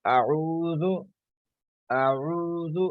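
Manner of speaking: "अऊज़ु" with the u held long, not औज़ु; long u sound. Shown as a demonstration. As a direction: stretch the vowel in "अऊज़ु" as madda. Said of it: "अब इसको औज़ु नहीं पढ़ेंगे". ("अऊज़ु" को मद्दा से खींचकर)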